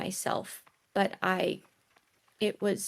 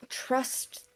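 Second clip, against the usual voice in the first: whispering; 5 semitones higher than usual. Acted. Emotional in-character answer.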